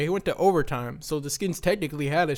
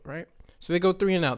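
usual speaking voice

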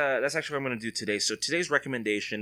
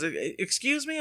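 imitating the woman; 12 semitones higher than usual. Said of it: "She goes".

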